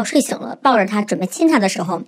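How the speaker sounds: normal talking voice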